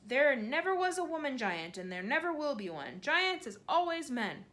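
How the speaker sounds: a big giant shocked at the question; giant crying out. A narrator's reading. BFG voice held in dialogue.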